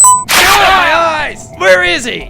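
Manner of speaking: shrieking, high and loud, blinded; pained shout. Acted. Blinded pirate shouting in pain and rage. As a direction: yell out in sudden pain.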